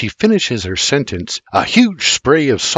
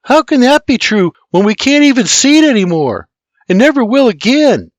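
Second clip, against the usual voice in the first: put-on much higher, louder whiny kid voice; groaning child; 13 semitones higher, 7 dB louder than usual. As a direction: complain like a sulky boy. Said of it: "James the Third groans".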